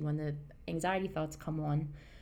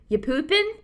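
put-on much higher, louder, laughing kid voice; son's question; 11 semitones higher, 10 dB louder than usual. Retelling her son barging into the bathroom, high laughing kid voice. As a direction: pop the kid's blunt question with a laugh. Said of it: "he goes".

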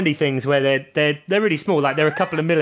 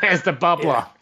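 lightly put-on Rhode Island accent; a hint of imitated Rhode Island. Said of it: "I love your Rhode Island accent".

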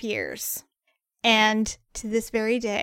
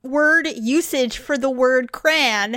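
raised, protesting retort; indignant objection; 5 semitones higher, 5 dB louder than usual. Retold or imitated. Arguing with her partner over crayon, indignant. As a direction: object to his word, pitched up a little. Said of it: "And I'm like".

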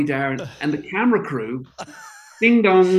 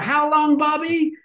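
high, lightly voiced [name] asking; mock sweet question; 13 semitones higher, slower than usual. [name] asks the impersonator how long, high mock voice.

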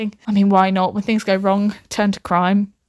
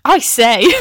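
louder, exaggerated questioning echo; odd mock echo; 6 dB louder than usual. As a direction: repeat it back with playful emphasis. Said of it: "why I said it like that".

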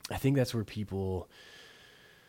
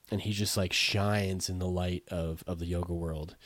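ordinary talk

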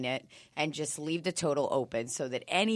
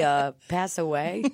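usual speaking voice

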